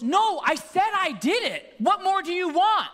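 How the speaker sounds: put-on high, loud child's protest; mock outrage, pitched right up; retold indignant outburst. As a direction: imitate an exasperated kid snapping back. Nephew snaps back, retold.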